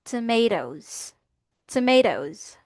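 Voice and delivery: "tomatoes" slow, as tuh-MAY-doze with a buzzed Z ending; "tomatoes" drawn out, falling, ending in Z; American model pronunciation. Shown as a demonstration. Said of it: "ang pronunciation natin dito is".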